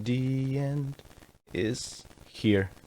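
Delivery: weak, fragile voice; frail voice. Shown as a demonstration. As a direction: say it as if all hope was lost. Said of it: "like, a frail voice saying".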